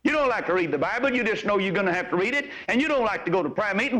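ordinary talk, nothing marked

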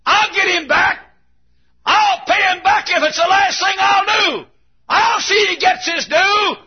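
very high, vengeful shout; acted high-pitched vengeful outcry; shouting back. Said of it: "Shallow shouts back".

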